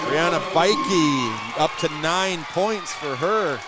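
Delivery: loud, drawn-out play-by-play; excited scoring call. Real, not acted. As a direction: call the basket loudly, stretching the words. Announcer calls a made basket.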